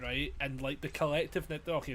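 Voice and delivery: normal talking voice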